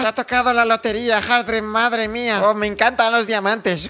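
high, loud, delighted put-on voice; tío Paco. Impostor shopkeeper hearing of Hardred's lottery win, gushing put-on voice. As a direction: gush loudly in a fast disguised villager voice. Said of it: "tengo que poner la voz del tío Paco".

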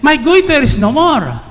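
high excited declaration. Retold or imitated. Woman telling of her healing, excited. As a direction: announce it with joyful amazement. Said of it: "So she was excited".

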